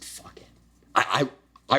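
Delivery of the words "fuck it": breathless whisper, almost no voice; whispered resolve. Retold or imitated. To himself on the gym couch, a whispered "fuck it". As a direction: hiss it to yourself under the breath.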